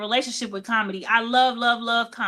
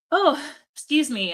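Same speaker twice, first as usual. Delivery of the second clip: higher voice through a yawn; 4 semitones higher than usual. Real, not acted. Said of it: "I'm yawning because I'm tired".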